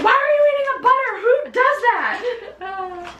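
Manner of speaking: very high, exasperated shriek with laughter; mock furious mom. Mom catching little sister eating butter, hammed-up angry shriek. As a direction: scold like an outraged mother, drawing out the end. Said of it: "get so freaking mad".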